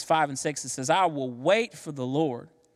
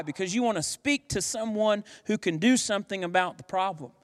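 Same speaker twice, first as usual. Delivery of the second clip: normal talking voice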